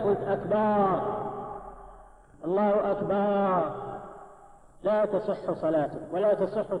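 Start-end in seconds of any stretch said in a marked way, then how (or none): 0.2-3.7 أكبر drawn out as "akbaaar"; faulty takbir ending "akbaaar"; long vowel after ba. Shown as a demonstration. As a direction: slowly stretch the last A of أكبر. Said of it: "أو مددت ال-، الباء".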